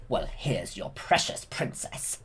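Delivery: slow, whispered taunt; villain whisper. Retold or imitated. Maleficent revealing the princess, slow whispered taunt. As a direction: breathe the taunt out slowly. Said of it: "she says".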